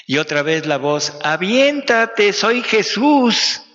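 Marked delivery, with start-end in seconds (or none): 1.2-3.6 high, loud, slow call from below. Acted. Jesús calling from below to the one on the board. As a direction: stretch it out as a far-off summons. The voice calls again.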